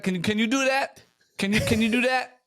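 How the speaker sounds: high, mimicked challenge; imitating Italian customer. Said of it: "how many times has she heard".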